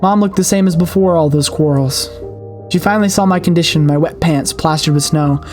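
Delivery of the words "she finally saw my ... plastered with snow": ordinary talk, nothing marked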